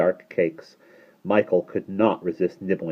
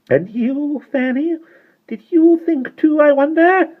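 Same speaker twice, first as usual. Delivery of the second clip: put-on very high, louder menacing little-old-woman voice; 10 dB louder than usual. A narrator's reading. Tiny mother questioning her other daughter, high and menacing. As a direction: turn on the next daughter with sly menace. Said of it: "The high little voice".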